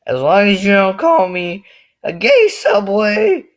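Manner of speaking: high, loud, drawn-out objection; mock outrage. Drawing the line at one insult, mock outrage. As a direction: belt it out in hammed-up indignation.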